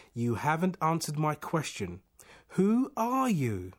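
high, rising insistence; agitated demand. A narrator's reading. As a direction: demand an answer, slowing on the question. Man demands to know who speaks.

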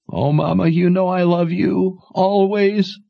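high, tearful agreement; high tearful yielding; tearful daughter. Acted. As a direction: give in through tears.

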